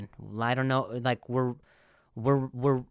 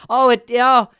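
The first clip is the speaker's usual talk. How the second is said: surprised; 9 semitones higher, 12 dB louder than usual. Real, not acted. Feeling forehead, discovers something.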